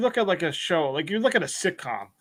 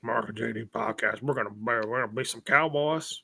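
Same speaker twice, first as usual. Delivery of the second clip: low gravel cowboy voice, lower and softer; gravelly Kevin Costner impression; 6 semitones lower, 5 dB quieter than usual. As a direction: growl it like a weathered cowboy. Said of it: "same gravelly voice".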